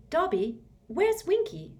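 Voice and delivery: a hint of young Hermione asking. Hermione asking the elf, lightly voiced.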